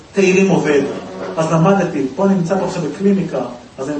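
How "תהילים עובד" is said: usual speaking voice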